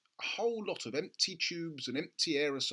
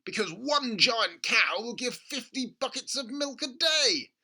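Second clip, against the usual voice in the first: much higher, louder, slower exclaiming; excited cry; 12 semitones higher, 9 dB louder than usual. A narrator's reading. Father crying out to George, excited. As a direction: cry it out waving arms. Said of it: "cried Mister Cranky".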